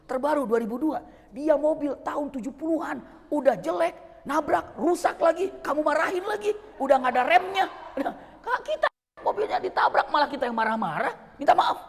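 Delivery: loud, high, laughing reproach; amused scolding. Retold or imitated. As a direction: scold him while half laughing at it. Sheikh lecturing driver, amused.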